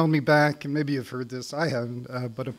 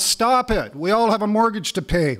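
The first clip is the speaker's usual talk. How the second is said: mock yell; 7 semitones higher, 6 dB louder than usual. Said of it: "Ken yelling out".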